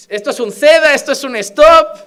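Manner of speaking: mock recital. Reciting driving theory signs.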